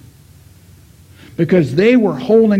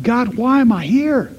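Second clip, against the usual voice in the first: higher, pleading call to God; pleading cry; 8 semitones higher, 4 dB louder than usual. Retold or imitated. Grieving widower crying out to God, higher and pleading. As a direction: cry out desperately upward. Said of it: "he's crying out to God".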